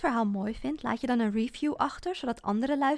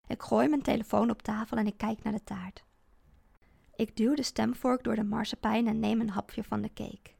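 ordinary delivery, nothing marked

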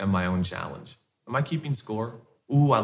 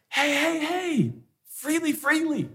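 much higher, eager hey hey hey; urging husband. Retold or imitated. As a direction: coax her to share freely. Husband urges wife.

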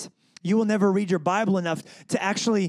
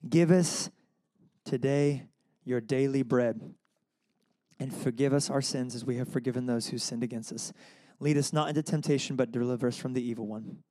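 prayer reading; 4 semitones lower, 4 dB quieter than usual. Acted. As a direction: recite it quietly, like a prayer. Reads the Lord's Prayer.

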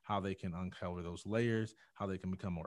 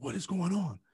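high, louder startled demand; alarmed doctor; 10 semitones higher, 7 dB louder than usual. Retold or imitated. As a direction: imitate a doctor bursting in on yelling. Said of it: "he comes in and he runs in".

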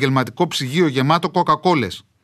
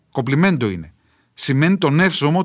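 ordinary delivery, nothing marked

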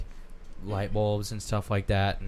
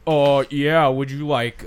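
scam caller; 3 semitones higher, 6 dB louder, slower than usual. Retold or imitated. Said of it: "they're like".

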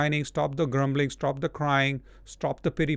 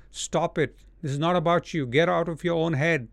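usual speaking voice

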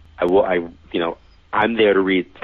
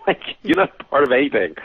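a laugh breaking into the retort, pitched up; laughing disbelief; 7 semitones higher than usual. Retold or imitated. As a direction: scoff it through a laugh. Scoffing at the psychic's claim.

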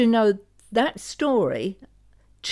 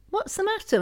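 a much higher, worried question; concerned question; 12 semitones higher, faster than usual. Retold or imitated. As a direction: ask it up high, anxious for him. Jesse asks tearful Jesus.

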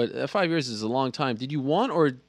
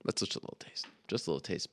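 whispered boast; 9 semitones lower, 12 dB quieter, faster than usual. Real, not acted. After beatbox, near-whispered boast.

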